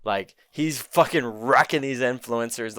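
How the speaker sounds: ordinary delivery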